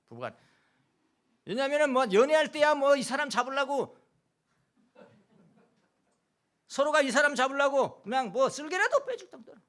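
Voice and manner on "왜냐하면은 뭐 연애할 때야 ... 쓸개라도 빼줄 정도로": mock eager lover. Pastor mimics dating couples eagerly.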